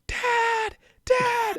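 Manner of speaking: put-on high, wailing child's voice calling for his dad; little kid crying dad. Retelling the movie, a lost little boy calls for his dad in a high voice. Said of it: "he's like, he's like".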